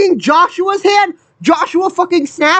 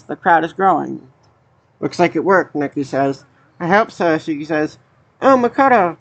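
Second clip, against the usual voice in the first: low, even voice reading the characters' lines; reading game dialogue; 8 semitones lower than usual. Acted. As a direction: read the on-screen text aloud, lower than own chat. Reads game dialogue aloud.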